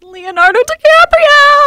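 high, loud star-struck reveal; excited. Real, not acted. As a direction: burst out with the name.

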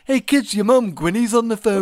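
put-on a cheeky Liverpool dad calling the kids. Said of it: "the only English accent I can do is, like, [name]".

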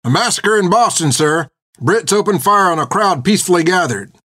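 high-pitched, urgent herald's proclamation; riled-up rider calling out news; agitated messenger. A narrator's reading. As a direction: proclaim grave news to the crowd.

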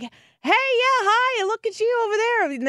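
very high-pitched mock hello; calling a greeting. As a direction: call out as if waving to someone over there. Imitates Trump greeting crowd while waving.

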